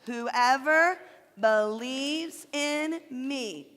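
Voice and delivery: very slow, word by word; slow recitation. Shown as a demonstration. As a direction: lead the kids through it slowly. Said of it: "let's put that much together".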